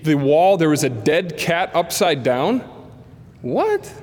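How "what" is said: sudden high squeak of shock; startled high what. Retold or imitated. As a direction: yelp it in disbelief. Shock at dead cat.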